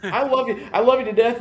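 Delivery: high, sing-song teasing love; mock affectionate. Dad teasing son over McDonald's choice, high sing-song. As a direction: gush at him like a doting dad.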